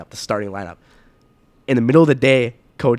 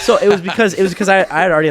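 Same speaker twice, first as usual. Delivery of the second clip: laughing; 8 semitones higher, 8 dB louder than usual. Real, not acted. Laughing while telling.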